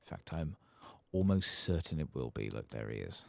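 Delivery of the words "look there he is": ordinary delivery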